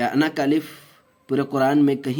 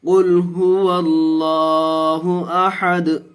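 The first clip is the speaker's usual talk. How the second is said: slow, high, drawn-out chanted recitation; Quranic recitation; 4 semitones higher, 5 dB louder, much slower than usual. Shown as a demonstration. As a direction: intone each syllable long and melodic like tajweed recitation. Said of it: "teacher recites verse".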